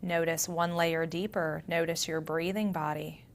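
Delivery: soft meditation voice with long pauses; guided meditation. Acted. Yoga teacher grounding listeners through their senses, guided meditation. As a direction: guide them gently inward, leaving space between phrases.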